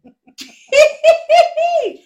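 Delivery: big real laugh; loud high laugh. Real, not acted. Co-host laughs at the joke.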